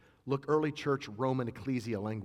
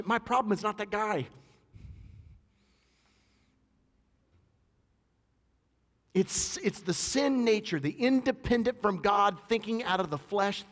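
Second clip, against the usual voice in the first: higher, louder full-force preaching; fiery preaching; 9 semitones higher, 6 dB louder than usual. Acted. Preacher exhorting the congregation to love, at full force.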